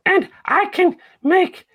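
slow, high ventriloquist voice; talking with mouth closed. Acted. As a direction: keep the lips still and squeak it out. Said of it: "talk without moving my mouth".

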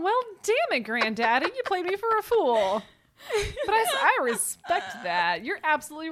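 high, loud mock indignation; mock outrage. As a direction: throw up your hands in fake outrage.

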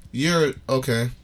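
ordinary talk

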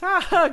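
high, loud mock laugh; imitated laugh. Teller mimics Sable laughing in the back, high mock laugh. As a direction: mimic her bursting out laughing. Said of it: "just back there just laughing".

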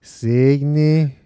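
drawn-out mock Cygni in a put-on voice; playful put-on voice; put-on lady voice. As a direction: echo the word in a funny voice. Mimicking bodega lady voice.

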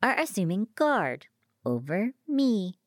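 sly, measured machine taunt. A narrator's reading. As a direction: draw it out knowingly. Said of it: "it said slyly".

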